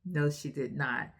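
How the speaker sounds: plain reading voice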